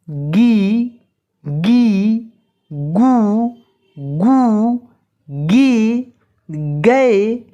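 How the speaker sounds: slow syllable-by-syllable drill, pausing after each; barakhadi recitation. Shown as a demonstration. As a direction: recite each syllable clearly for students to repeat. (बारहखड़ी का धीमा पाठ)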